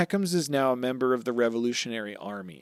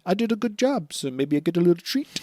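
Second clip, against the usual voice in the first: a hint of higher, coy asking; coy [name] voice; 7 semitones higher than usual. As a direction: fish sweetly for a reward.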